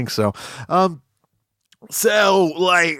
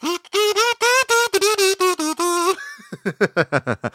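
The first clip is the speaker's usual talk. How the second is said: long high-pitched giggling; high giggle fit. Real, not acted.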